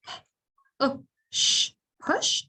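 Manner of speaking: "push" split into p, uh, sh, then blended; sounding out. Shown as a demonstration. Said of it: "So the a is going uh".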